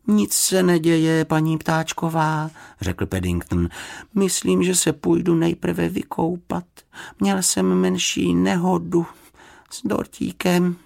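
a bear minding his manners; polite bear. A narrator's reading. Paddington voiced.

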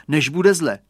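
ordinary delivery

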